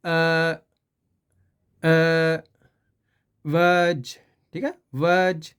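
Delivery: a slow "अ" then "वज" with a light buzz in the throat; throat vibration. Shown as a demonstration. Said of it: "जे vibration ही गले में".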